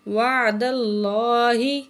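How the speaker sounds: اللہ with a full, heavy lam; heavy Allah. Shown as a demonstration. Teacher models heavy Allah after a fatha, slow recitation. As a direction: round and thicken the lam of اللہ. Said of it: "اللہ کے نام کو ہم موٹا کر کے".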